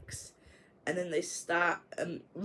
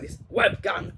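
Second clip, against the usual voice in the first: mock outburst; 3 semitones higher, 8 dB louder than usual. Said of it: "He's like".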